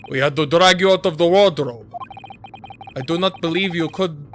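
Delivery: amateur mock-Russian accent; hammy game character with a Russian accent; terrible Russian accent. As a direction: put on a bad Russian accent. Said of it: "My accents are terrible".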